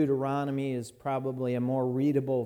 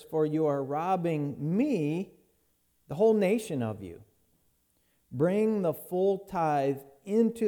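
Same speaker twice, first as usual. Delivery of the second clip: measured, solemn Bible reading; scripture reading; 3 semitones higher than usual. Acted. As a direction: read the Lord's words with weight. Reads the Malachi passage aloud.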